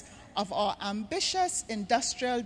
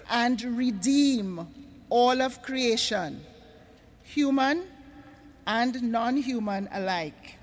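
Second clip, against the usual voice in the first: slow reading; slower than usual. Real, not acted. Speaker reading a startling statement to the audience, slowly. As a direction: read slowly with deliberate pauses. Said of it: "read it for you slowly".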